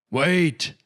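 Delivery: a loud, high, sudden yell. A narrator's reading. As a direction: shout to stop her leaping. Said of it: "yelled Reginald".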